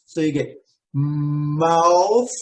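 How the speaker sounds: "mouth" said slowly with the tongue out for the th; th sound demo. Shown as a demonstration. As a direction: stretch out "mouth" and end on a clear th. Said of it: "bring your tongue out to the front of your teeth".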